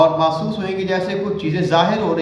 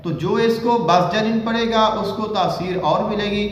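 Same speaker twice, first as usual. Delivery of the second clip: normal talking voice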